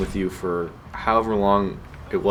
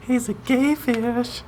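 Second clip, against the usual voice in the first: silly high voice; slower than usual. Acted. Silly voiced joke line.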